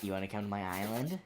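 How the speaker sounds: low, soft creepy invitation; creepy creep voice. Pretend. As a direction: whisper it like a lurking creep. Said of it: "stop sounding like Jeffrey Epstein".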